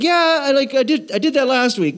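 high-pitched, hedging protest; whiny objecting congregant. Acted. Congregant bargaining with God for the judging role, high-pitched protest. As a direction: push back in a high pleading voice.

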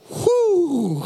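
long high-pitched "whoo". Retold or imitated. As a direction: whoosh out a high whoo like a whirlwind passing. Imitates whirlwind friend's effect.

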